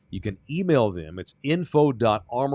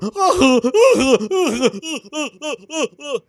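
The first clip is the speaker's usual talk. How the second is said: mocking laughter; 13 semitones higher, 8 dB louder than usual. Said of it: "and we were all going".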